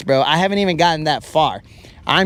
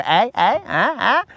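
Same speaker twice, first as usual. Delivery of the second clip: eyebrow-wiggling tease; 6 semitones higher than usual. Retold or imitated. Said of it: "You go".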